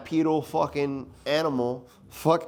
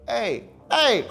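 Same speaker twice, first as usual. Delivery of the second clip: higher and louder, calling out sharply; a deputy yelling, spooked; alarmed yell; 9 semitones higher, 7 dB louder than usual. Retold or imitated. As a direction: call across to a kid staring into the woods. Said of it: "petrified to the point where I'm like".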